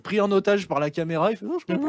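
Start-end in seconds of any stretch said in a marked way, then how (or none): 1.5-1.9 high squeaky little voice, much higher; small high-pitched voice. Retold or imitated. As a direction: squeak it out like a tiny frightened man. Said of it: "il avait une petite voix aiguë comme ça".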